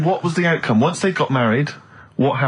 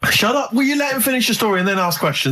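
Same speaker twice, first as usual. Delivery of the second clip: exasperated; 8 semitones higher than usual. Real, not acted. Scolding interrupting friend.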